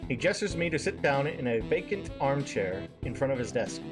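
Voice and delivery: usual speaking voice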